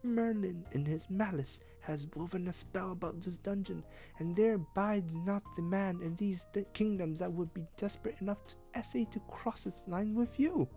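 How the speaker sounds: put-on hushed, fearful high boy voice. A narrator's reading. Page confides Merlin's spell to the prisoner, hushed and afraid. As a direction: confide a deadly secret, scared. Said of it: "told me his fearful news in a whisper".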